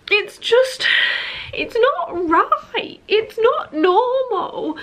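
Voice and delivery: high, strained voice near tears; tearful. Real, not acted. Vlogger addressing trolls watching her videos, tearful. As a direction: push through the upset.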